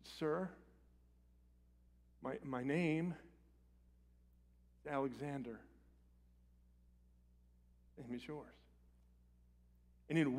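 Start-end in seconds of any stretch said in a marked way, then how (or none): 0.0-8.5 put-on a soft, hesitant, fading boy's voice; frightened boy. Terrified young soldier answering Alexander the Great, voice fading. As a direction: stammer it out, afraid and small. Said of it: "the boy looked up".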